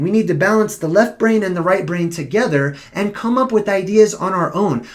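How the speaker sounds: ordinary talk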